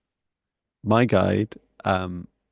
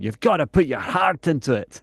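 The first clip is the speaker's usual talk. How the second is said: a hint of a Scottish accent, firm and urging; firm Scottish-accented guide giving advice; 6 semitones higher than usual. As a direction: play the guide with a light Scottish accent, pressing the point. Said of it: "like, almost in a Scottish accent".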